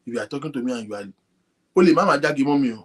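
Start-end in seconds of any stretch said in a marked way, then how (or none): none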